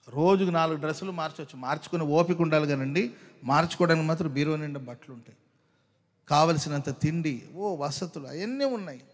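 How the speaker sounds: usual speaking voice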